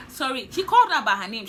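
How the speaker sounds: normal talking voice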